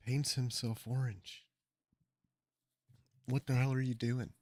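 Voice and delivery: ordinary delivery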